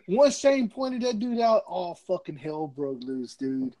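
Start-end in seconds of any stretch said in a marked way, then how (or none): none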